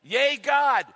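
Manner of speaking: high, loud cheering for God; fan cheer. Acted. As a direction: cheer it like a touchdown. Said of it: "it's just saying".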